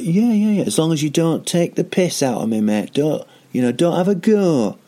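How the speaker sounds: high, hammed-up Manchester accent. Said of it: "what's happening with my accent".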